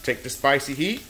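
ordinary talk

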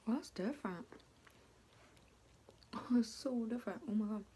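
speaking between bites; talking while chewing. Real, not acted. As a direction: mouth partly full of food. Seen 2: Bites piece at -3, jaw working, cheek bulge at 1.4.

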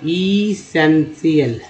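Essential drawn out slowly, part by part; syllable by syllable. Shown as a demonstration. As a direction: break essential into slow, separate syllables. Teacher breaks word into parts.

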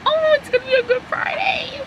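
giddy high good-news voice; excited. Real, not acted. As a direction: lift it way up with joy. Paying it forward at drive-through.

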